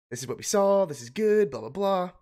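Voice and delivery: imagined cheerful doctor. Pretend. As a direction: playfully voice a doctor bringing good news, pitched high. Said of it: "they're gonna come in and say".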